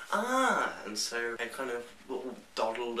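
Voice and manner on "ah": excited yelp. Retold or imitated. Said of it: "And I was like".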